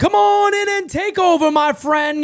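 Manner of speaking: loud, high hype handoff to the co-host; announcer handoff. Real, not acted. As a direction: call the next guy in, pumped up. Announcer hands over to co-host.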